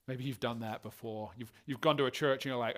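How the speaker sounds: ordinary talk, nothing marked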